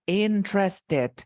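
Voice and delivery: INTERESTED broken slowly into three syllables, IN-TRES-TED; "interested" drawn out, syllable by syllable. Shown as a demonstration. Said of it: "Three syllables".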